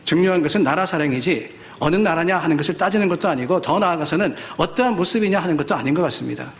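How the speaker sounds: ordinary talk, nothing marked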